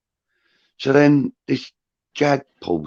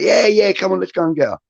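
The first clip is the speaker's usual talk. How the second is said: eager tipsy insistence; 11 semitones higher than usual. Retold or imitated. Tipsy wife urging again.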